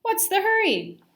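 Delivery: put-on high, loud, surprised old lady; a bright little grandma voice; lightly voiced grandma. A narrator's reading. Grandma startled when Simon runs into her, high and lively.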